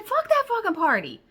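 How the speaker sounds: high, loud swearing; high, loud swearing outburst; exasperated outburst. Retold or imitated. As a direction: blurt it out, fed up. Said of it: "That's exactly what I said".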